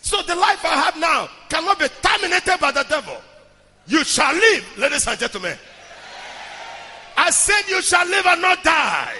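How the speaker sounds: emphatic, rousing sermon delivery; full-force preaching. Real, not acted. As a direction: preach it hard to the congregation. Preaches to congregation.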